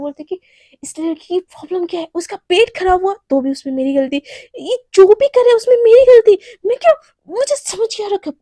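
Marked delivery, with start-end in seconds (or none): 4.6-8.4 loud, higher exasperated outburst at her friend; fed-up outburst. Acted. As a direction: blow up, fed up with being blamed. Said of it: "उसका दिमाग ख़राब हो जाता है".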